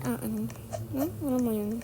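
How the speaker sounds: normal talking voice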